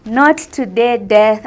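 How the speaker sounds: defiant shout. Retold or imitated. Defying death, shouting at it.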